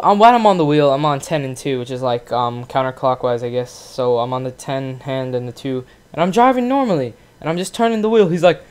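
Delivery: ordinary talk, nothing marked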